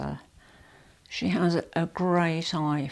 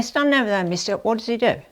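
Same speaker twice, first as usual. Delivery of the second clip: loud, higher telling-off; loud bossy call; 5 semitones higher, 7 dB louder, faster than usual. Real, not acted. Wife loudly ordering her husband to stand over there. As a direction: boss him into place.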